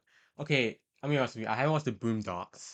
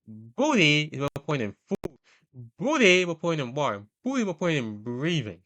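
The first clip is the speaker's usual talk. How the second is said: loud, raised-pitch impassioned rant; Fleece Johnson; 4 semitones higher, 6 dB louder, faster than usual. Acted. Host acting out Fleece's speech after the truck hit, loud impassioned impression. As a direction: declaim it like a fervent creed, picking up speed. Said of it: "going with his dad about".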